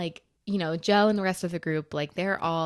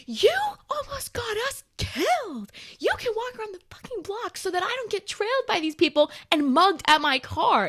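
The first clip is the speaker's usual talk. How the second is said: high-pitched retold furious rant, building louder; angry outburst; 13 semitones higher, 6 dB louder than usual. Telling off Joe for the danger, angry outburst. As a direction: scold him high and forceful, loudest at the end.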